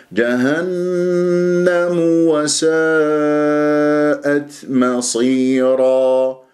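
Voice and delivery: slow chant, جهنم linked by mu into وساءت; joined recitation. Shown as a demonstration. Teacher models joining جهنم to وساءت, sustained tajwid chant. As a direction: hold n and long aa through جهنم وساءت. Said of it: "بالوصل تكون ميم مضمومة".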